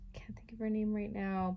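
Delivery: whispering to herself; under her breath, unvoiced; whispered aside. Real, not acted. Searching memory, aside.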